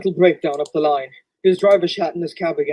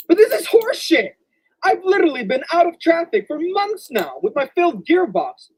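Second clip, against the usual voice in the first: outraged; 12 semitones higher than usual. Acted. Engine protests being withdrawn.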